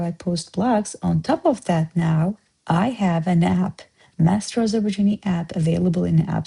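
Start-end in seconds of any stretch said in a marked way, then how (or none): none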